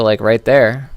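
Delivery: usual speaking voice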